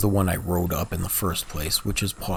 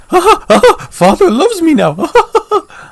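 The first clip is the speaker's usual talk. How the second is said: high, loud, crazed cackling impression; unstable psychotic voice; 11 dB louder than usual. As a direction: cackle shrilly like someone completely unhinged, much higher and louder. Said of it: "best impression of a unstable, psychotic voice".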